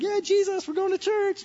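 high, sarcastic cheer; sarcastic high-pitched kid voice; mock kid enthusiasm. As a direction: sell fake excitement about church. Kids' sarcastic churchgoing enthusiasm.

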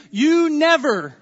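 slow, blaming "You never"; accusing. Shown as a demonstration. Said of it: "or even this one".

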